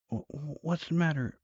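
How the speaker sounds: high-pitched, stammering woman's voice; put-on female voice, stuttering start; confused wife.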